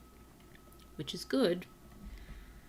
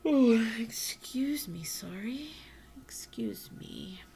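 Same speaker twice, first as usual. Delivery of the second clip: slow, breathy yawn while apologizing; yawning through words; 5 semitones higher, 5 dB louder, slower than usual. Real, not acted. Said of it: "I did yawn".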